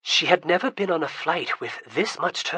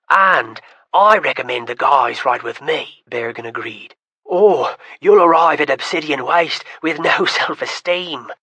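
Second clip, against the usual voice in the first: a lightly voiced young man, louder, chuckling; teasing young man; 9 dB louder than usual. Berrigan offers the guys a ride, mocking his sister. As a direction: fire the joke back with a laugh.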